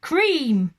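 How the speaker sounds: loud, bitter cabbie word; bitterly. A narrator's reading. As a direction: spit it out in disgust. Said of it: "he said bitterly".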